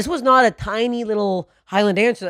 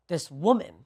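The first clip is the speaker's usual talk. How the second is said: ordinary talk, nothing marked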